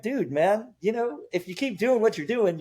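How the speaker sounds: high, exasperated lecturing of a client; mock-exasperated lecture to a stubborn client; fed-up trainer. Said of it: "And I'm like".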